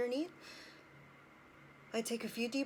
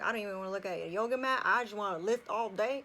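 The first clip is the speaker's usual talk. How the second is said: fast, louder, psyched to lift all day; pumped-up quote; 6 dB louder, much faster than usual. Retold or imitated. Quoting herself on gym days, fast and pumped. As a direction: blurt it out like you can't wait to lift. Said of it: "And then there's days where I'm like".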